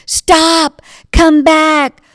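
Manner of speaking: high, loud shout. Acted. Jazz player chases the beignet boy, loud high shout. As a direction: yell after the runaway.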